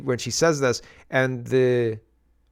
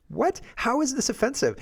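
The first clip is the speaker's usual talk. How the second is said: much higher, incredulous what; baffled disbelief; 12 semitones higher than usual. Real, not acted. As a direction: ask it in sheer disbelief. Baffled by the outrage over "born and bred.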